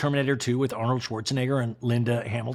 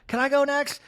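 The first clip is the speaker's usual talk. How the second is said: eager Kyle; 12 semitones higher, 3 dB louder than usual. Retold or imitated.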